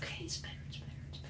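whispered self-reassurance through pain; whispering. Real, not acted. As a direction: whisper it to yourself to hold on. Enduring ice.

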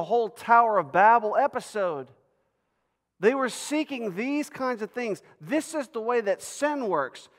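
high, loud, emphatic sermon delivery; impassioned preaching. Real, not acted. Preacher explains God's promise to Abram, impassioned preaching. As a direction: drive the point home to the congregation.

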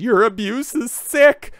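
high, slow, whiny mock of the wife. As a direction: parody her complaint in a high drawn-out voice. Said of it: "Hillary Crowder says to her husband".

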